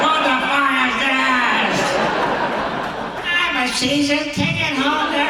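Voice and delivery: high, slow mock outrage; outraged patron. Drenched ticket holder protesting, high mock indignation. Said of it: "She's like".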